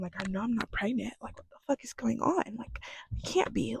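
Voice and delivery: retold self-talk, half-whispered; hushed disbelief. Recalling herself puzzling over the vomiting, hushed disbelief. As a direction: mutter it softly to yourself, baffled.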